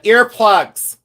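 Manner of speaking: retold order, punched out loud; exasperated repeated order. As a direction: call it out to a machine that didn't understand. Repeating order to uncomprehending computer.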